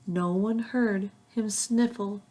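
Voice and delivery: ordinary delivery, nothing marked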